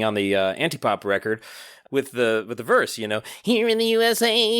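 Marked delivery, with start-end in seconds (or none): 3.4-4.6 high, pinched voice way up in the nose; high-pitched nasal song imitation. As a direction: chant it high through your nose. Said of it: "that more nasal approach".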